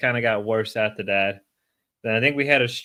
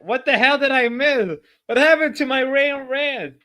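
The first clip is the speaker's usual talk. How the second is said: high, loud "what the hell"; shocked outburst; 14 semitones higher, 5 dB louder than usual. Real, not acted. Streamer shocked by what chat says, high and loud. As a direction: blurt it in disbelief.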